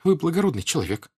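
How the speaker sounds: high, tearful appeal; hurried sobbing patter. A narrator's reading. Woman turns to Pierre, sobbing patter. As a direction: gabble it through little sobs. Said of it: "скороговоркой всхлипывая".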